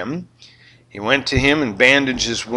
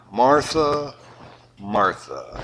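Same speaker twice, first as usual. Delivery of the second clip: soft, falling double name; gently chide, second name dropping away; gentle Jesus; 3 semitones lower, 4 dB quieter, much slower than usual. Retold or imitated. Jesus gently correcting busy Martha. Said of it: "Jesus replied".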